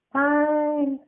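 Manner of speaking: put-on high childlike sigh of giving in; high grudging "fine"; reluctant little boy. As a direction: kid caving in. Boy gives in.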